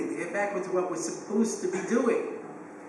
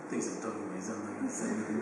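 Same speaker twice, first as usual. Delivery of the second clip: low, much softer grumble to himself; mumbling under breath; 10 semitones lower, 10 dB quieter, much faster than usual. Retold or imitated. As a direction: mutter it so the parent can't hear. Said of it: "I could mumble under my breath to myself".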